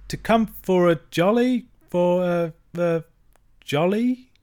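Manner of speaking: high, halting, uncertain trailing question; faltering. A narrator's reading. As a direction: lose your nerve word by word, with pauses. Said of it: "faltered and fell away".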